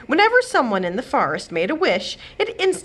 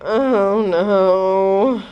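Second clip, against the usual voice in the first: groaned; 6 dB louder than usual. Acted. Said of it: "groaned the fairy godmother".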